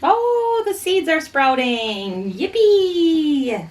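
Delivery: high, delighted squeal; thrilled at the sprouts; excited. Real, not acted.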